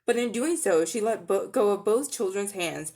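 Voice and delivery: usual speaking voice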